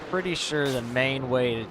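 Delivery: ordinary delivery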